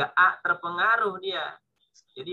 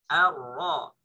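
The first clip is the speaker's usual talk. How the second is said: normal talking voice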